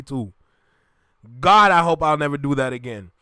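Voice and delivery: ordinary talk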